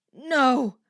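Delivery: loud, high defiant scream. Acted. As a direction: scream it in refusal. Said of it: "a voice screamed in her head".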